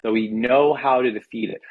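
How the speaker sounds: ordinary delivery, nothing marked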